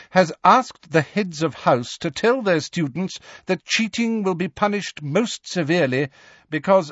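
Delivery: high, strict schoolmistress voice; McGonagall. Acted. Professor briefs class on exam rules, sternly. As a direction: lay down exam rules formally, pitched high.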